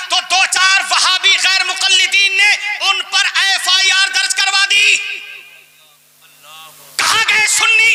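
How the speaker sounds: high-pitched sermon oratory; fiery preaching. Real, not acted. Preacher warning the congregation about a rival group, fiery preaching. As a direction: ring out each phrase high, then pause.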